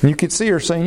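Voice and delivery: usual speaking voice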